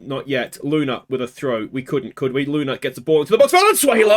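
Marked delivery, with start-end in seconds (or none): 3.3-4.1 sudden hopeful yell of a name; excited shout. Real, not acted. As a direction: shout the name as the ball flies in. Hopeful cross into the box.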